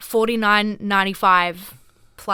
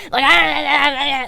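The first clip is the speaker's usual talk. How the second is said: mimicked angry sputter; 5 semitones higher, 6 dB louder, much slower than usual. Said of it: "getting really mad".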